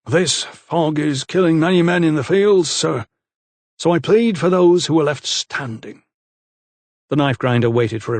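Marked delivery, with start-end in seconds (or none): 0.1-6.0 lightly voiced bold farmhand; lightly voiced insolent young man. A narrator's reading. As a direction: speak up politely but cheekily. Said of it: "So Pike continued".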